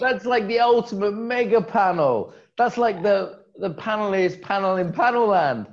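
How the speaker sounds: high, loud gushing excitement; loud, high-pitched enthusiasm; amazed hype. Real, not acted. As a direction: rave about it at full pitch. Amazed at the panel lineup.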